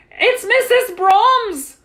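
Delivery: very high, loud, drawn-out shout; alarmed cry. A narrator's reading. Superintendent reacts to commotion, high alarmed shout. Cry at woman's hysterical entrance.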